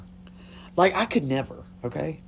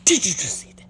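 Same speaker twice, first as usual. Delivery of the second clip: breathy, whispered amazement; awed whisper. Retold or imitated. Teen reacting to what she just saw, awed whisper. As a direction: whisper it as if stunned by the sight. Retold awestruck first reaction to shooting.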